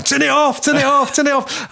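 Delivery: high, urgent cry to turn it off; yelling in alarm from the back room; panicked shout. Retold or imitated. Jamie shouting from the back room to shut off the power. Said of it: "Jamie in back room were going".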